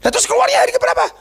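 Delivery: very high, incredulous retelling; exasperated questioning. Retold or imitated. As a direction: pitch it way up, as if protesting. Acted exasperated questioning of healed woman.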